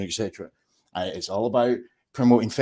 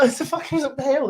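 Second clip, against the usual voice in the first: mock thick Keith accent. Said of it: "what the hell you said there".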